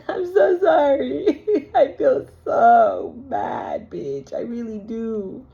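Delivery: slow, hammed-up fake crying through laughter; laughing so hard it turns into mock sobs; mock sobbing apology. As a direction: wail out a fake sorry while cracking up.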